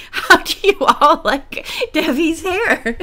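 reading through real laughter; laughing. Real, not acted. Retake of line through laughter.

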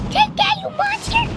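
high-pitched attack yelp; battle cry. Real, not acted. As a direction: squeal it as you strike the monster. Attacking the boss.

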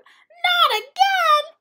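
very high, drawn-out loud yelp. A narrator's reading. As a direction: wail it in dismay as Coyote. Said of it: "Coyote yelped".

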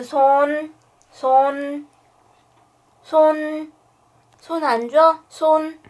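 high, loud command for paw; firm dog commands. Real, not acted. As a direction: demand the paw, firmly. (높고 단호한 명령)

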